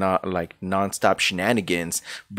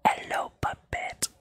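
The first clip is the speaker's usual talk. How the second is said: ASMR whisper; 10 dB quieter, slower than usual. Pretend. Mock ASMR greeting.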